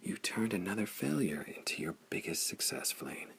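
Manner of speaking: soft, awed whisper of praise; hushed admiring whisper. A narrator's reading. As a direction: breathe it in wonder. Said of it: "Evelyn whispered".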